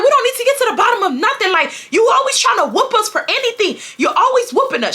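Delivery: yelling. Retold or imitated. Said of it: "I'm screaming".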